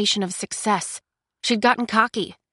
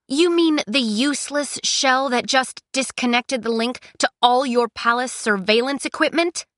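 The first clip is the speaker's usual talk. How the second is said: higher, bold retort; confident defiance; 6 semitones higher than usual. A narrator's reading. Cress standing up to the thaumaturge, confidently defiant. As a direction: throw the insult back, pitched up. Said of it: "a look more confident".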